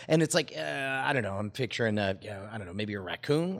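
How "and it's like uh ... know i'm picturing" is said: normal talking voice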